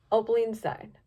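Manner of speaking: usual speaking voice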